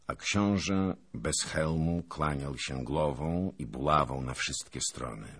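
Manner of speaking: plain reading voice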